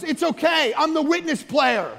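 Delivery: reassuring excuse. Retold or imitated. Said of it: "he goes".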